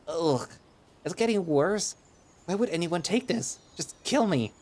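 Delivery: whining in high disgust; high, loud disgust. Acted. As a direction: beg for it to stop, gagging. Gagging on the taste.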